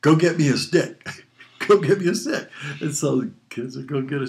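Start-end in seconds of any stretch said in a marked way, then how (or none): 0.0-1.2 mom's order. Retold or imitated. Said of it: "She says". 1.7-2.5 higher, louder stern mom demand; mom ordering. Retold or imitated. Mother sending her son for a paddling stick, a stern high command.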